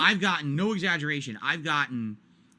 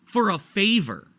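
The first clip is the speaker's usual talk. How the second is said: louder, slower, outraged repeat; indignant disbelief; 6 semitones higher, 6 dB louder, slower than usual. Real, not acted. Streamer ranting to chat about a favor request, outraged and louder. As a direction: hammer the absurdity of the favor. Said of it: "Listen to this".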